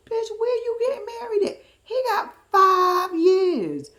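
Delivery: hammed-up exasperated disbelief; incredulous challenge. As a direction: wail it high, stretching the last words. Challenges friend about the wedding.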